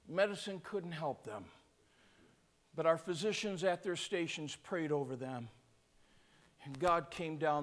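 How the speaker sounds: low, soft, reverent preaching; hushed testimony. Real, not acted. Preacher telling how God healed people in their seats, hushed. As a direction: bring it right down, quiet and awed.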